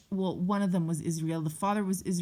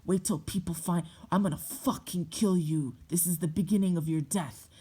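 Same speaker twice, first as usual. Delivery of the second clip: imitated threat, held down rather than shouted; retold death threat in an altered voice; mom freaking out; 2 semitones lower than usual. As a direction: menace it like an enraged mother. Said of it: "my mom starts freaking out".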